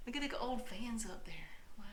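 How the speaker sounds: soft unvoiced whisper. Real, not acted. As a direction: keep it down so nobody hears. Whispering in case someone's watching.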